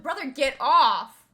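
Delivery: firm slow command, told for laughs; retold scolding. Telling the panting dog on the blanket to get off, loud slow scold. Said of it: "I'm like".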